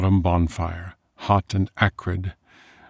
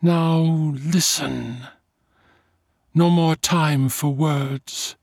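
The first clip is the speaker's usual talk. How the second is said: high, slow, otherworldly command; slow vampire command; 10 semitones higher, slower than usual. A narrator's reading. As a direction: stretch every word like a spell.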